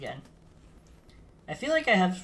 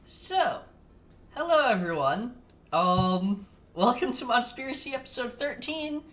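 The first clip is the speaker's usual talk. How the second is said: show host intro; 9 semitones higher, 4 dB louder than usual. Acted. Opening the podcast episode.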